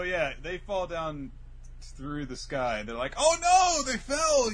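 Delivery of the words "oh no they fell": high, loud cartoon dismay; hammed-up "oh no" wail; mock alarm. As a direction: cry out in put-on panic. Acted alarm of the characters.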